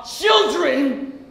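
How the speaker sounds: put-on "children" at full voice, drawn out; rising outrage. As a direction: hurl "children" at her in accusation. Repeats word with outrage.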